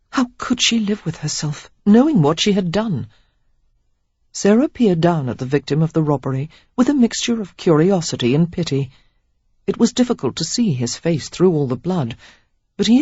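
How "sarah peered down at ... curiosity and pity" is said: usual speaking voice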